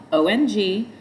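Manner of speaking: ordinary delivery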